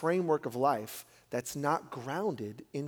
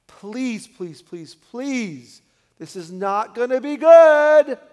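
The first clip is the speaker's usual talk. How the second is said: pleading parent; 7 semitones higher, 14 dB louder, much slower than usual. Acted. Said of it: "if you're a parent".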